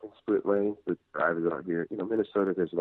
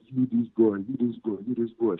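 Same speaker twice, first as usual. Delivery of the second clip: put-on mom's Nigerian accent, scolding; heavy Nigerian accent, raised pitch; 5 semitones higher than usual. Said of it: "She's got a heavy Nigerian accent".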